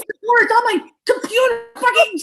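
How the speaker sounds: a full meltdown, howling for mom to hurry; crying tantrum. Acted. Son melts down, crying.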